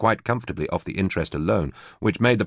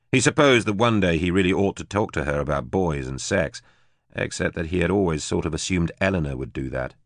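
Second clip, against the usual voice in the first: plain reading voice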